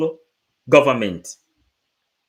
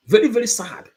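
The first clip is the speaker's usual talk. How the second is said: grieving emphasis, pressed out louder; very, very sad; 7 semitones higher, 4 dB louder than usual. Real, not acted. Preacher telling the crowd it is sad. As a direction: lift the voice and lean hard on very, very. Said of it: "I am delivering it so that you will feel sad".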